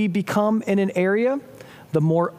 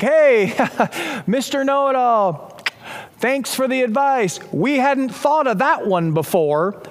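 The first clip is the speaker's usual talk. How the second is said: raised, drawn-out mock gratitude; heavy sarcasm; 5 semitones higher, slower than usual. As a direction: shout it back with exaggerated sarcasm. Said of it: "with heavy sarcasm coming from the boat".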